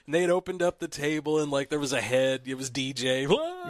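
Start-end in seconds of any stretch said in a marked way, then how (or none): 3.3-3.7 spooky "wah" scare. Retold or imitated. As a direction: pop out with a sudden boo. Kid scaring in Roseanne scene.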